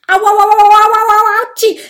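loud, high string of ows; pained wailing. Acted. Dragon dreading the needle, wailing loudly. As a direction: wail in exaggerated pain. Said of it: "jammert der riesige Drache mit schmerzverzerrtem Gesicht".

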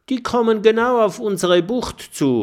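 hoch und laut, ganz aufgeregt; aufgeregt rufen. Acted. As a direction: alarmiert den Freunden zurufen. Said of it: "ruft Mathilda aufgeregt". (Mathilda warnt die Freunde vor dem Schiff, laut und aufgeregt)

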